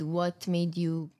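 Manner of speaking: usual speaking voice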